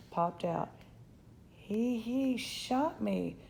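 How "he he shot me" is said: slow, higher, halting disbelief; shocked voice. Acted. Boy just shot by a tiny cowboy, shocked. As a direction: stammer it out in stunned shock. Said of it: "in a shocked voice".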